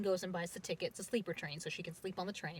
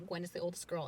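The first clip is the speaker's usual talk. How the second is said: ordinary talk, nothing marked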